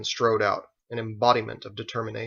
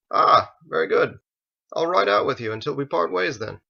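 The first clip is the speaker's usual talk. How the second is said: pleased eager reply; 5 semitones higher, 6 dB louder than usual. A narrator's reading. Michael pleased.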